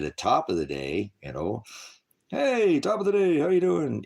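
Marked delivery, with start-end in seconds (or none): 2.3-4.0 high, loud, hammed-up chirpy greeting; mock cheery greeting. Mocking a chipper coworker's greeting, high and loud. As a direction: play a bright fake-friendly coworker saying hello.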